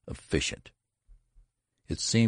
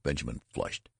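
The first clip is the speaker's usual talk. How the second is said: ordinary delivery, nothing marked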